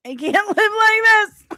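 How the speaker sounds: high and loud, laughing mid-line; mock despair cracking into a laugh; comic wail of despair. Real, not acted.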